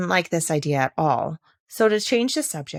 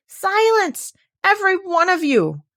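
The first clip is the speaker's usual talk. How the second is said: very high, louder order for silence; commanding cry; 13 semitones higher, 6 dB louder than usual. A narrator's reading. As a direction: silence the whole flowerbed. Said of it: "cried the tiger lily".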